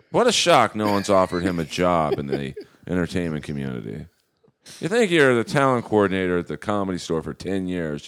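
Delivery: loud mock outrage; rising, heavy sarcasm; mock indignant rant; sarcastic. Real, not acted. Sarcastic rant about coordinator.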